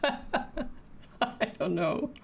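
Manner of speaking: slow, laughing through the words. Real, not acted. Painter confused by their own pronunciation, laughing. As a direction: let the laugh stretch the words out.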